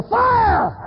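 high, drawn-out scream; screaming alarm. Acted. Warning someone on a burning roof, screamed alarm. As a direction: scream it like a warning to save lives. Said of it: "And I'm screaming".

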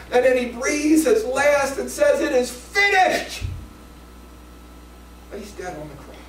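loud, high, building sermon; preaching at full force. Retold or imitated. Preacher shouting the crucifixion story to the church, high and loud. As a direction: drive it home with fire.

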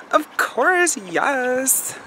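high, bright over-the-top eagerness; mock eager yes. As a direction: gush it like you're thrilled to help. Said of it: "And I'm like".

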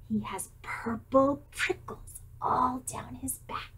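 slow soft whisper. Acted. Mouse whispers the Gruffalo's looks. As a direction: keep it secret and hushed.

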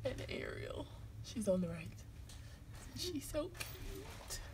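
soft whisper so nobody wakes. Real, not acted. Filming sleeping friends, hushed whisper. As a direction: breathe the words, barely voiced.

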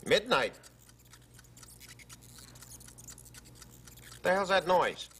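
high startled voice; nervously shooing away mice. Acted.

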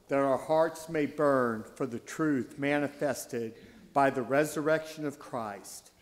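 normal talking voice

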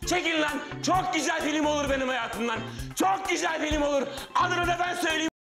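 high-pitched, anguished shouted rant; full-blown escalation. Acted. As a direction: wail in outrage like a man betrayed by everyone. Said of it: "yüksel [name]".

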